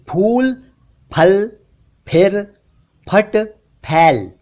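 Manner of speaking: फूल, फल, फिर, फट, फैल each with p plus breath; spaced words, lips popping each ph; aspirated ph. Shown as a demonstration. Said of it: "इस तरह से बोलना चाहिए".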